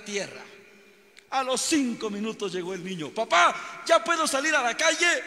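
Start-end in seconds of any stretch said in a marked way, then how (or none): 3.1-5.2 put-on higher and louder, a restless kid pleading; begging little boy. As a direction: beg your papa to let you out and play. Boy asks father once more.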